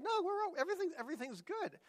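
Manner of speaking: high, quick brush-off; hasty reassurance. Retold or imitated. Girlfriend brushing off the fight to a housemate, high and hurried.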